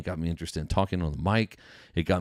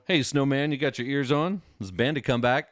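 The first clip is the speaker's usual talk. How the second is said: higher, louder mock trucker on the radio; CB trucker lingo; 7 semitones higher, 3 dB louder than usual. A dad quoting Smokey and the Bandit CB talk, mock trucker call. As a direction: call out like a trucker on channel nineteen. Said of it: "learn all about C B lingo".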